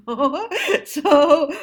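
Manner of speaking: breaks into a loud laugh; real amused laugh rising up. Real, not acted. Laughing at her own joke.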